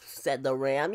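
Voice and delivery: plain reading voice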